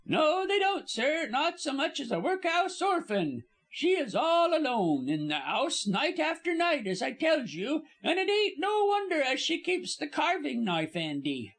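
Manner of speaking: high-pitched chattering servant, dropped aitches; high, scandalised working-class gossip; gossiping Cockney servant woman. Acted. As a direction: prattle on indignantly in a high Cockney voice. Narrator plays the gossiping servant.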